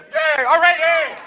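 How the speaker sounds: high, loud cheer; hammed-up celebration; mock cheering. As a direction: whoop it for the winners. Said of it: "and I went".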